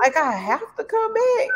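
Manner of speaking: imitating herself, high and pleading; exasperated quote. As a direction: beg like you can't take another day. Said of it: "I just be like".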